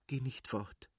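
soft, hurried plea in labour; urgent plea. Acted. Kitty begs Levin not to leave, soft and urgent. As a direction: beg him quietly not to leave. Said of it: "stieß sie rasch hervor".

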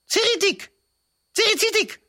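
very high, piercing creature squeak; shrill creature cry. Acted. A snarling creature cries out, very high and piercing. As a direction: shriek it like a tiny teeth-baring beast.

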